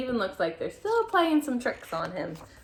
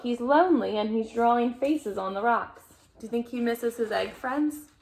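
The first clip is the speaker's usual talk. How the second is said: ordinary delivery, nothing marked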